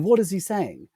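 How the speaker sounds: high retold bafflement; baffled assistant. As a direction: imitate someone totally lost by the accent.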